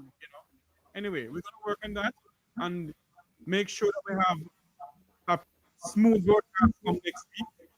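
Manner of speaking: ordinary talk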